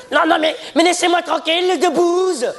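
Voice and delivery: put-on high, fast protesting girl. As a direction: squeal it like an annoyed girl. (voix de fille aiguë)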